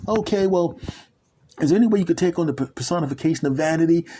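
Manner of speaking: high, fast sheepish asking; sheepish request. Acted. Man asking God to look like [name], sheepish. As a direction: ask hopefully.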